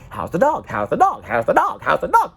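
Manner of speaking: sing-song mock of relatives asking; mocking family. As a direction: mimic them asking over and over. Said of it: "they kept asking".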